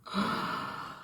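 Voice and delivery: soft, breath-only sigh. Real, not acted. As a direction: let out a quiet, pained breath. Sighs at the painful memory.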